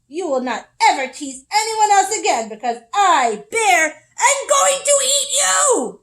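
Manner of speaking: growled. Acted. Bear pinning Squirrel, loud growling threat. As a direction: growl it, building to a loud high roar. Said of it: "Bear growled".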